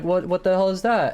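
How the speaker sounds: lightly voiced bewildered father; mock baffled father. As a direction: play the puzzled dad, half-hammed. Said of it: "He's like".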